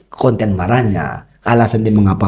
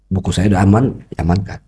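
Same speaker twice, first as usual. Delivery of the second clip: ordinary talk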